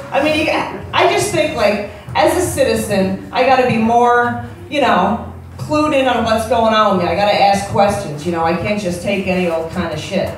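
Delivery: ordinary talk, nothing marked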